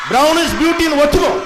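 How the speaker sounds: drawn-out rousing call to accept it; rallying shout. Real, not acted. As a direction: proclaim it to the whole hall. Rallying the audience.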